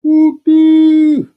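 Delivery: very high, loud cry from under a pile; old man's muffled cry. Acted. Buried old man crying out for help. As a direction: squeal it out from under the clothes. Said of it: "this muffled sound".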